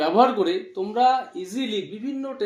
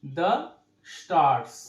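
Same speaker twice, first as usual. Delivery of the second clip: slow dictation; much slower than usual. Shown as a demonstration. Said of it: "tো he rubbed shoulders with".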